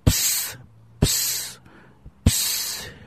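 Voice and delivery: soft breathy psst hisses from the snake. Acted. The serpent hissing softly at the young wife. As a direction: hiss each psst like a serpent. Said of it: "siffla-t-il".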